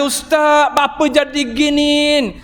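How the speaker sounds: put-on crying girl begging the ustaz in Terengganu Malay; Terengganu dialect. The crying girl pleads with the ustaz, in Terengganu dialect. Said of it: "Orang Terengganu".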